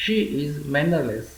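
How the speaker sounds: very slow, careful teacher read; slow model sentence. Shown as a demonstration. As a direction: lay each word out slowly for learners. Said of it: "teacher models English sentence". (ધીમે ધીમે સ્પષ્ટ ઉચ્ચાર)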